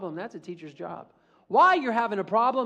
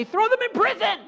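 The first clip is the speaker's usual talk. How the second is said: whiny demand; 5 dB louder than usual. Acted. Whining to the judge.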